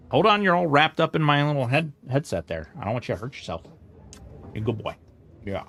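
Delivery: soft doting dog talk; praising the dog. Real, not acted. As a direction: coo over him like a proud owner. Praising the pug softly.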